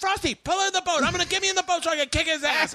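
high strained angry orders; hammed-up raging friend; imitated yelling. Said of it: "while he's yelling".

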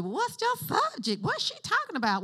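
high-pitched mock questioning; squeaky-high imitation of a listener; mimicking confused listeners. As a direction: pitch it way up like a puzzled newcomer. Mimics newcomers asking her subject.

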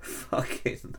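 whispered, breathy and soft; hushed tones. Pretend. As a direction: whisper it like a secret. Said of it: "in hushed tones".

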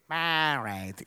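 "Buried," in a low mock-English accent; English pronunciation. A Scottish preacher imitates how English people say it, mock English accent. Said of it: "I know that English people say".

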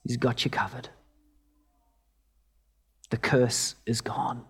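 a hushed, tender promise; said simply. Retold or imitated. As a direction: lay each word down gently, like a reassurance. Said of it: "my prof just said simply".

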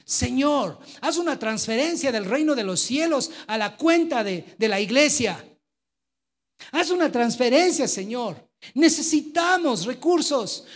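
loud, fervent pleading prayer, pitched high; retelling a loud prayer in church. Retold or imitated. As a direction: cry out to God for the money. Retold fervent prayer for money.

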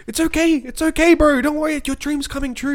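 high, soothing mock reassurance; comforting big guy. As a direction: comfort him like a big friendly bro. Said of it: "this big Maori dude's like".

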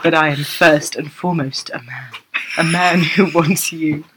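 low purring seducer; low mock-seductive purr. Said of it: "Shut up, I'm trying to purr".

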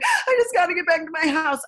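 high-pitched wailing re-enactment; imitated sobbing. Mother re-enacting her breakdown on a walk, imitated sobbing. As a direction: act out a panicked sob. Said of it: "I literally was sobbing".

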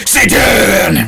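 calling Satan back down the hallway; retold shout. Shouting Satan back at his classmate in the hallway. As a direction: bellow it back at a friend. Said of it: "and I went".

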